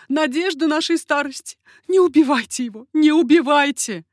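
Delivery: loud, high pleading cry; mother's cry. A narrator's reading. Mother begging the killer to spare her son, crying out. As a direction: plead at the top of your voice. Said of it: "кричала мать".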